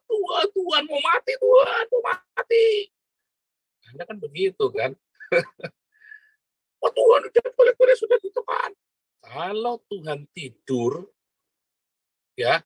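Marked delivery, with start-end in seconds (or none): 0.1-2.7 mock panicked screaming. Preacher mocking a panicking believer who cries to God, shrill hammed-up wail. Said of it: "Jangan malah teriak-teriak, kejang-kejang, jejerita". 6.8-8.7 hammed-up frantic squeal, much higher and fast; mock panic. Preacher parodying a believer panicking in the storm, frantic squeal. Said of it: "Jangan malah teriak-teriak".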